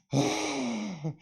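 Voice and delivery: acted sigh of relief; relieved sigh. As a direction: let the breath go in relief. Acting out relief downstairs.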